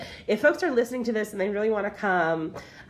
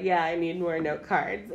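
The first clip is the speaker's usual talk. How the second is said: ordinary talk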